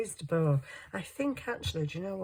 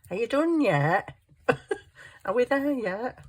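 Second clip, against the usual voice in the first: high, loud, whiny "are we there yet" pestering; hammed-up impatient child, laughing through it; impatient kid voice; 8 semitones higher, 6 dB louder than usual. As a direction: nag like a bored child on a car trip. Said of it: "I'm like".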